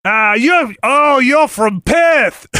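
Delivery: high, drawn-out mock Aussie; Australian accent. Greeting the emailer from Perth, Australian accent. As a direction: shout it in a broad bad Australian accent. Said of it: "my Australian accent".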